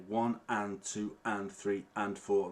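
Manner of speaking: steady slow counting, "and" between each beat; teacher slowly counting the offbeat. Shown as a demonstration. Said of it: "I'm gonna count it".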